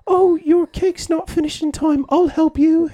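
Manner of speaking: high, slow helpful baker voice; sweet British baker. Retold or imitated. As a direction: coo it like a kind British baker. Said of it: "in England, they're like".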